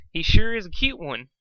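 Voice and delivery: ordinary delivery, nothing marked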